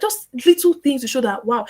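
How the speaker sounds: usual speaking voice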